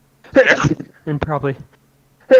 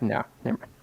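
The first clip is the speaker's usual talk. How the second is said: quiet, sheepish backing down; meek mumble; 4 semitones lower, 11 dB quieter, faster than usual. Pretend. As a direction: drop to a timid murmur. Instantly backing down, imagined scene.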